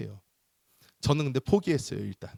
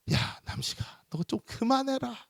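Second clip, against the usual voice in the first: pleading mother; 11 semitones higher, 3 dB quieter than usual. Retold or imitated.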